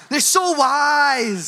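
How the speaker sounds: "so wise" slow, high and loud, laying it on; mock-gushing kid overdoing it; sarcastic child, "so" stretched out; sarcastic praise.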